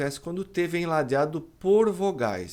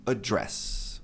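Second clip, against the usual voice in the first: "address" as a-DRESS, lower; weak opening, stressed DRESS in "address"; verb stress; 5 semitones lower than usual. Shown as a demonstration. Teacher recaps the verb, end-stressed word. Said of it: "Mas o verbo".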